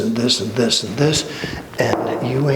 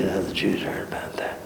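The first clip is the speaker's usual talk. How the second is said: fast, whispered guarantee; 6 semitones lower, 10 dB quieter, faster than usual. Shown as a demonstration. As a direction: lean in and whisper the certainty. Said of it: "You don't think".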